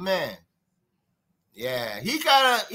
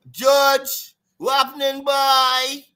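higher, bright Trinidadian lilt calling George; Trinidad accent; 6 semitones higher, 5 dB louder, much slower than usual. Retold or imitated. Showing how Trinidad talks, a Trinidad accent hailing George. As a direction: Trinidad accent, friendly street greeting. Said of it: "You know how Trinidad talk?".

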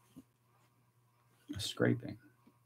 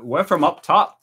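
raised, amateur Australian accent; attempted Australian accent; 5 semitones higher, 6 dB louder than usual. Shown as a demonstration. Streamer joking with Australian viewers, rough Australian accent. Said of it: "Do your, your best Australian accent".

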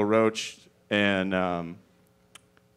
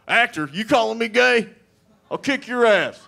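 much higher, loud defensive threat; mock macho anger; 9 semitones higher, 4 dB louder than usual. Tough guy offended at being called actor, loud threat. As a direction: bristle like a touchy tough guy. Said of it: "He's like".

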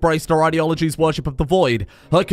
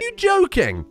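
much higher, pleading with the game in disbelief; exasperated disbelief; 11 semitones higher than usual. Real, not acted. Player's colony collapses, exasperated high-pitched disbelief. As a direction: ask the universe why, voice pitched way up.

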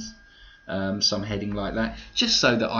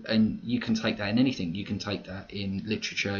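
normal talking voice

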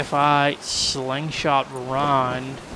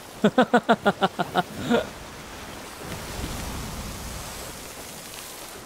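breathy laugh; 7 semitones higher, 4 dB quieter than usual. Real, not acted. Laugh after sinking brig.

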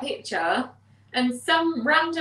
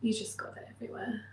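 whisper; 11 dB quieter, faster than usual. Real, not acted. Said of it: "really weird you eating on camera".

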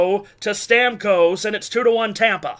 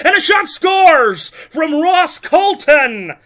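loud shout with a slowed goal line; goal call; 5 semitones higher, 3 dB louder, slower than usual. Real, not acted. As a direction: shout the shot that scores.

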